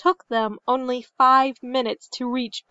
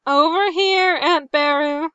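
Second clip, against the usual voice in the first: put-on raised, grumbling boy's reply; 5 semitones higher, 4 dB louder than usual. A narrator's reading. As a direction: grumble back to an aunt, pitched up. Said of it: "turned with a wince and grumbled".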